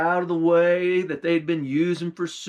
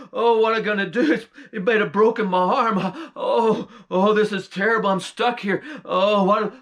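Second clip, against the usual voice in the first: panicked bear wail; 5 semitones higher than usual. Acted. Trapped bear fearing the hunter, higher frantic wailing. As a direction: wail in panic, caught in the trap.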